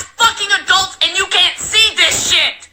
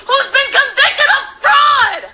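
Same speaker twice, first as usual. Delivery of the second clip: higher, furious outburst; angry shout; 5 semitones higher, slower than usual. Real, not acted. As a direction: yell the accusation. Rant peaks in a shout.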